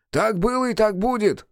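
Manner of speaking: high, distant solemn voice; ancient being. A narrator's reading. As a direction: intone it like an old spirit.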